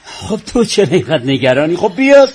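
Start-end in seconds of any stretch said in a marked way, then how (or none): 1.8-2.3 forced breezy. Acted. Father dismisses the worry loudly.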